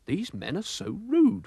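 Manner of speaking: lightly voiced huffy gentleman; indignant old gentleman. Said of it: "thought Mister Oldcastle".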